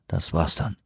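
leise und tief, resigniert; gedacht. Acted. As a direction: sag es leise nach innen. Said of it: "dachte Johansson". (leiser, resignierter Gedanke)

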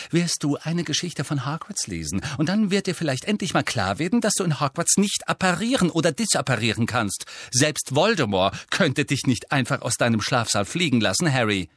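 thoroughly annoyed. A narrator's reading. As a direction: lecture him in annoyance. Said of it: "klang gründlich verärgert". (gründlich verärgert belehrend)

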